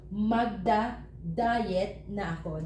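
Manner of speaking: magda-diet na ako said slowly and evenly; slow model phrase. Shown as a demonstration. Teacher modelling a Filipino sentence, slow and clear. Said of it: "That's how you say it in Filipino".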